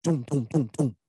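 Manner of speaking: knocking sounds made with the voice; imitated window knocking. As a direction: thump out the knocks on the glass. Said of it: "they knock on your window like".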